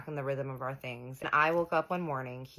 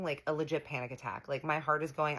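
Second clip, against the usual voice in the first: usual speaking voice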